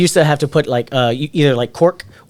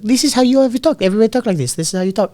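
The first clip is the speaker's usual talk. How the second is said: imitating own old accented English, pitched up; imitated accented English; 8 semitones higher, faster than usual. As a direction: protest in a high, put-on accent. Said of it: "you can't fucking speak English like the rest".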